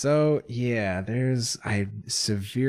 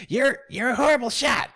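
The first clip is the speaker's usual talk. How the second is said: high rat voice; high-pitched rat mockery; scornful rat; 14 semitones higher, 4 dB louder, faster than usual. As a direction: jeer in a high creature voice. Rat answers the rabbit excuse.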